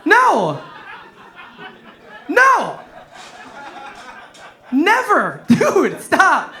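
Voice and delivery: comic indignant yelling; mock outrage. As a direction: shout each word out in falling, indignant bursts. Mock outburst at laughing audience.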